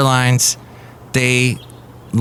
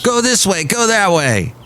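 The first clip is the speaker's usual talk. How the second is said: high barked drill sergeant orders; 9 semitones higher than usual. Retold or imitated. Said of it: "like a drill sergeant yelling at him".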